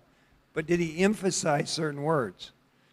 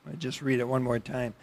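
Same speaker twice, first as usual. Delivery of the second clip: usual speaking voice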